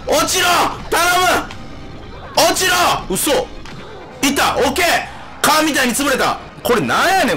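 high excited yells; shouting. Real, not acted. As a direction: yell at the game. Boss fight shouting at game. (興奮して叫ぶ)

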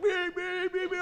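high loud beeping; imitated car horns. Drivers behind the slow truck, high loud honking. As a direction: honk it like impatient drivers. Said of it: "people are like".

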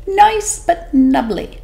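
a tiny sly squeak; 'stute fish voice. Acted. Small fish tempting the whale, high and sly. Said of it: "said the small 'stute fish".

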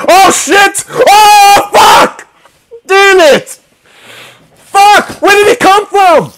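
high, loud panicked shouts; real fright, scream it out. Real, not acted. Player screams as monsters ambush his bed, panicked screaming.

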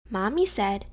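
plain reading voice

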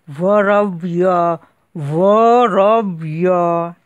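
slow, muffled, through closed teeth. Acted. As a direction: say it with the jaw shut. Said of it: "будто воду глотаешь".

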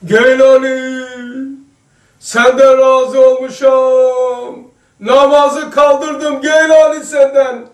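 slow, loud, drawn-out proclamation from above; Satan's voice. Retold or imitated. Preacher retelling Satan calling out to Geylani, slow loud proclamation. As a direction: stretch every word like a false heavenly voice. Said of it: "Duymuş şeytan sesini".

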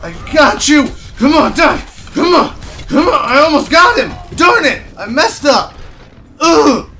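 high, loud, rapid-fire outbursts; heated gamer rage; frustrated yelling. Real, not acted. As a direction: shout in exasperation at the near miss. Nearly gets a kill, misses.

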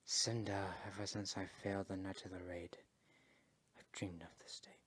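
low, near-whispered and solemn; deep, quiet, heartfelt; hushed confession. Acted. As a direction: confide a long-held regret softly. Confesses old failure at reunion.

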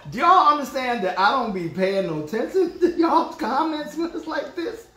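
high laughing rant. Real, not acted. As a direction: laugh while telling them off. Laughing at comments.

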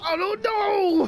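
high, slow wail; crying. Real, not acted. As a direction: whine through tears. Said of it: "Why are you crying?".

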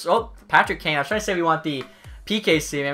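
ordinary delivery

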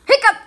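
sudden loud hic; loud hiccup. Pretend. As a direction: blurt out a hiccup.

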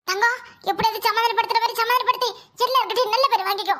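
normal talking voice

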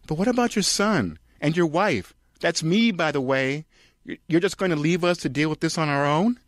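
high, loud mock plea; pleading Charlotte. Wife begging her husband to stay, high pleading. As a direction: beg him not to leave.

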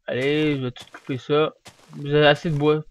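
normal talking voice

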